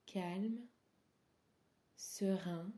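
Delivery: ordinary delivery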